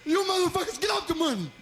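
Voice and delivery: high, loud mock robbery shout; tough stickup voice. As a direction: bark the stickup like a tough guy.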